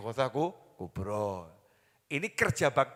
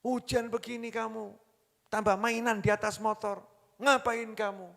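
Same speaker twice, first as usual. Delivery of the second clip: higher-pitched mock scolding of a child; a retold mother's scolding, pitched up; 7 semitones higher than usual. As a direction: play the mother snapping at her kid in the rain. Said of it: "Dimarahin sama ibunya".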